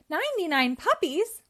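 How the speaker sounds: can't believe the number; astonished cry. Acted. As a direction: Pongo amazed at ninety-nine puppies. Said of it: "cried Pongo".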